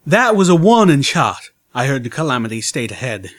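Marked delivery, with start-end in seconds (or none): 0.1-1.5 talking around a gun in his mouth; muffled and loud, mouth full; muffled mumble. A narrator's reading. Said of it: "his voice muffled and slightly mumbling".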